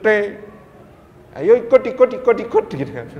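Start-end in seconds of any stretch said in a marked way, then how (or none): 1.3-2.7 higher, louder Balinese accent with thick heavy T. Shown as a demonstration. Preacher shows how to talk with people from Bali, in a Balinese accent. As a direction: hit every T in "ikut" thick, Bali style. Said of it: "T-nya jadi tebel".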